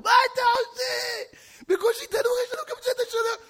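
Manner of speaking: scandalised wife screaming at her husband; wife's wailing protest. Acted. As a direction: wail it in hammed-up horror. Wife wails over broken oven.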